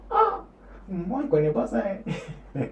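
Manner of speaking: voix de comédie très haut perchée; refus comique du froid. Pretend. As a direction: pousse un « ah » suraigu puis proteste d'une petite voix aiguë. Plaisante sur le froid, puis rit. (protestation jouée, aiguë, pour rire)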